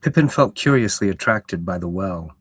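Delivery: steady storyteller reading aloud; story narration. A narrator's reading. Streamer reading the book to her chat, storyteller voice. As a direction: read it like a fantasy audiobook.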